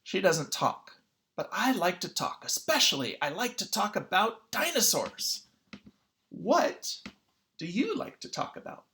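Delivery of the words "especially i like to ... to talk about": plain reading voice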